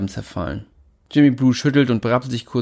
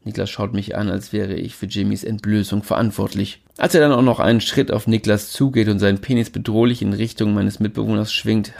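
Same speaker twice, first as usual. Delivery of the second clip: plain reading voice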